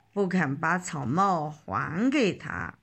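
ordinary delivery

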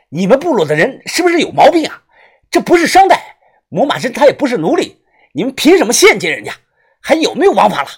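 高声怒斥; 掐着他的脖子痛骂. A narrator's reading. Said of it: "呵斥着". (愤怒地高声呵斥)

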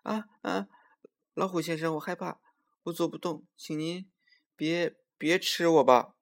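high, halting, frightened squirrel; feigned fear. A narrator's reading. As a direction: plead in a put-on scared little voice. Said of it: "装出很怕，害怕的声音来".

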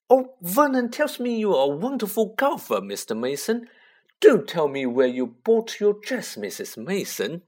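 high simpering aunt voice; gushing society lady voice; Aunt Petunia voice. A narrator's reading. Aunt rehearses compliments.

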